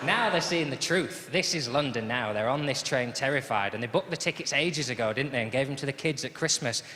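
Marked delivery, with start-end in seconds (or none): none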